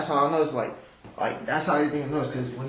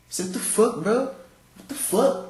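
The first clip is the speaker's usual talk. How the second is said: retold outburst; 8 semitones higher, much slower than usual. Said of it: "he said".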